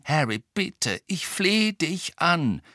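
slow, drawn-out begging; desperate plea. A narrator's reading. Hermine begging Harry, desperate. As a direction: implore him desperately. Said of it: "sagte Hermine verzweifelt".

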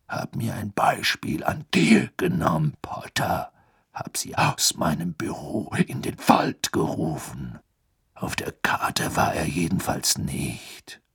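put-on rasping, breathy old auror; gruff Moody. A narrator's reading. Moody tells the children about the map, rasping. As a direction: rasp it out low and weary.

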